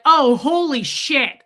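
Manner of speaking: loud, drawn-out exasperated curse; angry swearing. Retold or imitated. Customer whose bag just split, angry exclamation. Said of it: "I was really angry at this point".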